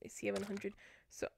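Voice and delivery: soft unvoiced whisper; just breathe the words out. Real, not acted. Whispered aside.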